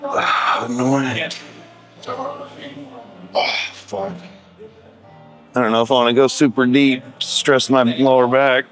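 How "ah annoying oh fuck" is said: straining. Real, not acted. Struggling mid-set.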